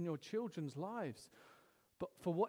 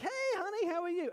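high, bright call to his daughter; dad calling to daughter; cheery dad greeting; 12 semitones higher, faster than usual. Retold or imitated. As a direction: greet your little girl brightly.

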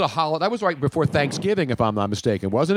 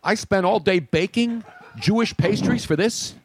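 high Yiddish grandma kvetch; yiddish accent; 6 semitones higher than usual. Retold or imitated.

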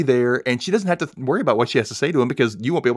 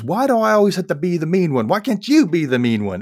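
higher-pitched mock scolding; chewing out; 7 semitones higher, 3 dB louder than usual. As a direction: act out an exasperated wife's complaint. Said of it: "Patty's chewing out Graham".

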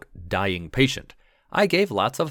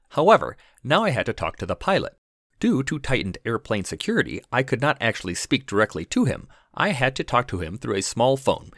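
ordinary delivery, nothing marked

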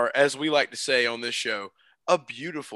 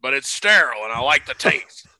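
loud, fast put-on character voice; movie quote impression; 5 semitones higher, 9 dB louder, faster than usual. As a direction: belt it like the movie line. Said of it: "He's like".

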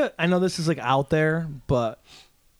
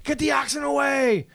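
shouting; 9 semitones higher than usual. Pretend. Panicked ox handler yelling.

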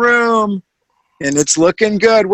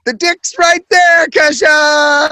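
high, loud mock guy; hammed-up guy voice; 9 semitones higher, 4 dB louder than usual. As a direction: squeal it out like a dumb guy. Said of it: "He's all".

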